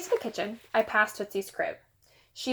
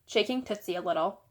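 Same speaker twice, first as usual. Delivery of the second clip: plain reading voice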